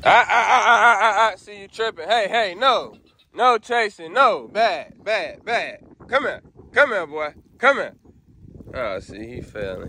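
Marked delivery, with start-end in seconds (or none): 0.0-2.1 high, loud laughing "ah ah" at the dog; loud high laughing warning. Real, not acted. 2.1-7.9 high, loud commands to stop chasing; loud high scolding of a dog. Real, not acted.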